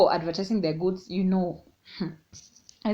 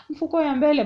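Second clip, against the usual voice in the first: nervous fib; 8 semitones higher, 6 dB louder, faster than usual. Retold or imitated. Lying to mom about pocket.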